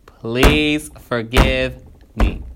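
drawn-out plea. Real, not acted. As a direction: stretch each word out, begging. Pleading after cringing at own writing.

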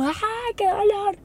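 high, hammed-up wail of pain; mock cry of pain. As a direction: overplay the agony as a joke. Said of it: "No era". (queja de dolor exagerada)

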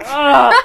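loud groan of annoyance, acted out; exasperated groan. Reader fed up with spoilers, loud exasperated groan. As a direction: groan like it's been spoiled for you. Reaction to being given spoilers.

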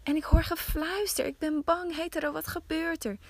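high, scared calls; a wife waking in terror in the dark; frightened wife crying out. Acted. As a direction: Higo calling to Hetaro in fear at night. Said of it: "ze schreeuwde".

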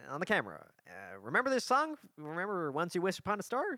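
high, chirpy cartoon cricket voice; Jiminy Cricket voice. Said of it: "I'll do Jiminy Cricket".

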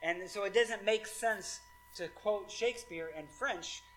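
ordinary talk

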